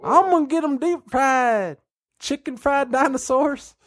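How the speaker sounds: high drawled food order. Acted. Playing God ordering food.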